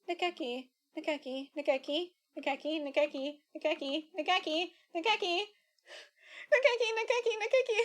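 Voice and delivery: unhinged, calling the name over and over; manic calling. Acted. Possessed teacher frantically calls for Nakaki, manic rising calls.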